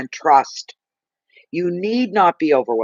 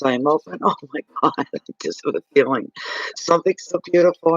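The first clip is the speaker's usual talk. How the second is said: ordinary delivery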